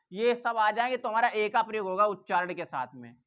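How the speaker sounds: ordinary talk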